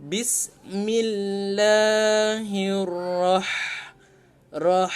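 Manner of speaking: "bismillahirrah" drawn out very slowly with a spicy h; slow recitation. Shown as a demonstration. Teacher recites the phrase slowly with the spicy ha. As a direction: recite slowly, hot breathy h. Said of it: "so dekat sini".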